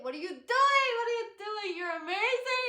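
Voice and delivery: loud, over-the-top excited reaction; excited shouting. Retold or imitated. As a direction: shout it in high-pitched amazement. Acting out her loud excited reaction.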